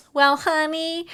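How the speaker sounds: high-pitched condescending counselor imitation; patronizing. Counselor patting the client's hand, mock patronizing. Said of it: "patronizing you going".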